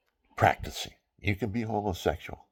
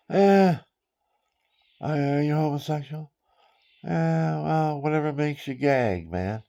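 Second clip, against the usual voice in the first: high, loud jeering "Eh, well"; loud mock challenge; 10 semitones higher, 7 dB louder than usual. To the listener, teasing about being homosexual, loud and high. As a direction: call it out big and high, needling. Same line said again, now loud.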